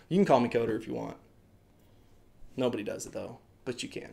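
low, soft, trailing afterthought; under-the-breath concession, fading away; muttered aside. Real, not acted. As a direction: drop to a quiet, low mumble.

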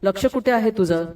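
ordinary delivery

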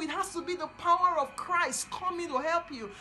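loud, rising preacher's cry; fiery preaching. Acted. Preacher urging the captive daughter of Zion, loud and fervent. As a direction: preach it out with full force.

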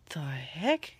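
whispered puzzled question. Real, not acted. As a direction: breathe it out, puzzled. Reacts to a filter.

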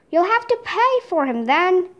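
plain reading voice

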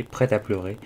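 usual speaking voice

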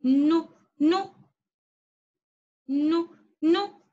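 "نو" as a short "nu", slowly repeated; nun with damma. Shown as a demonstration. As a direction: drill "نو" in separate, slow "nu" syllables. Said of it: "نون مع الضمة كيف ننطقه؟". ("نو" بنون مضمومة، ببطء)

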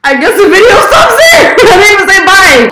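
high wailing shout with a laugh; loud dismayed outburst. Real, not acted. Old video cuts off without goodbye, loud high wail.

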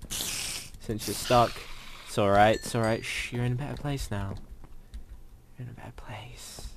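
hushed comforting whisper; mock soothing whisper. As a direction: soothe it softly before the kill. Killing a stuck spider, mock comfort.

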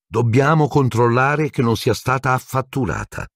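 high brisk stern teacher, no preamble; strict professor getting straight to it. A narrator's reading. McGonagall confronting Harry about the broom, brisk.

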